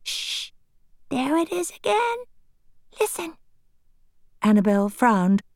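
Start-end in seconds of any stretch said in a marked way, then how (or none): 0.0-3.4 soft, hushed and higher. Acted. Tiffany hushes Annabel to listen for the voice. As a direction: keep it down to catch the sound.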